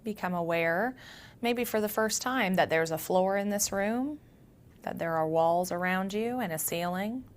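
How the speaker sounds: soft meditation voice with long pauses; guided meditation. Acted. Yoga teacher grounding listeners through their senses, guided meditation. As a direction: guide them gently inward, leaving space between phrases.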